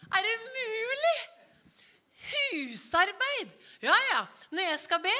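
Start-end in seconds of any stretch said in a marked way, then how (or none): 0.1-3.5 high, loud, dragged-out disbelief; mock dismay. Pastor confessing to the congregation that housework beats him, with mock high disbelief. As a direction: play up the shock like it's absurd. Confessing his worst prayer rival.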